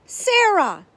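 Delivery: loud, high call across the room. A narrator's reading. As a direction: shout for her from the door. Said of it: "he called in a loud voice".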